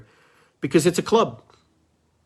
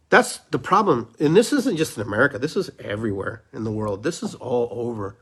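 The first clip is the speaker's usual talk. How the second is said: ordinary delivery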